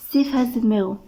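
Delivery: plain reading voice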